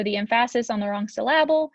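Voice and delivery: "emphasis" and "syllable" stressed on their second syllables; joke misplaced stress. Shown as a demonstration. Teacher joking about sounding funky, "emphasis" and "syllable" stressed on second syllables. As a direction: say em-PHA-sis for "emphasis", syl-LA-ble for "syllable". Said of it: "I almost said emphasis again".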